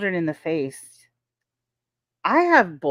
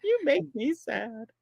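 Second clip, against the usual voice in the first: put-on slow little-kid voice, softer; toddler pout; 6 semitones higher, 6 dB quieter, slower than usual. Toddler complaining to his mom, soft pout. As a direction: mimic a small sulking child. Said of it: "he goes".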